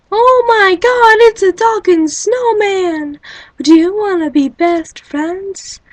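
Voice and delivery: high, loud and slow, starstruck; thrilled gushing. Retold or imitated. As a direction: gush over the talking snowman like meeting a celebrity. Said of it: "You're like".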